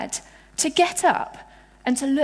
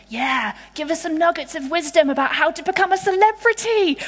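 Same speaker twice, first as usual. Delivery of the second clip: mock eagerness; 4 semitones higher than usual. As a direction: pitch climbing steadily with mock excitement. Voicing eager disciples.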